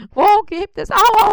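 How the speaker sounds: high, loud wailing promise; whimpering spider in pain. Acted. Spider wails his promise to Bissi, high and hurting. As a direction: wail it out, half laughing through the ache.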